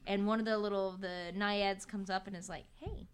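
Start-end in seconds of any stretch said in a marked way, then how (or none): 2.8-3.1 soft naiad voice. Retold or imitated. Naiad quietly telling Percy to scoop sand, soft and hushed. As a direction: murmur it like a gentle water spirit.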